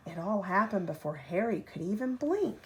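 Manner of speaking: plain reading voice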